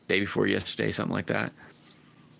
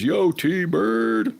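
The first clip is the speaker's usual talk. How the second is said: high, loud put-on voice for "Yo, T-Bird"; reading a chatter's line; 13 semitones higher, 6 dB louder than usual. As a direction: voice the chat message as a different dude. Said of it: "Random Rick says".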